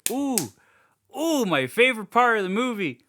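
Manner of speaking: high, loud rush of delight; excited. Real, not acted. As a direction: gush about your favorite part. Eagerly reaching favorite scene.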